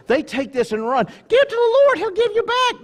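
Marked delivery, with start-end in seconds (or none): 1.3-2.8 high, loud mock hype; hammed-up pitched-up promise; health-and-wealth preacher. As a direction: shout it like a prosperity preacher. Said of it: "how the health and wealth go".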